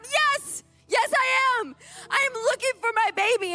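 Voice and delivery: high, emphatic, desperate retort; defiant outburst. Retold or imitated. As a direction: fire back as a frantic mother. Defiant mother answers being called crazy.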